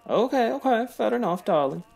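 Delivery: camp Italian lover voice. Acted. Flamboyant Carlo voice to Patrick.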